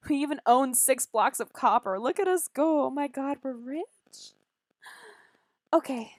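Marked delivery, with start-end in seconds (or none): 0.1-3.8 mock rich boast.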